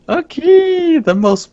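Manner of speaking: high, loud excited squeal; a sudden thrilled yelp. Real, not acted. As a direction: burst out about the prize. Excited about login bonus.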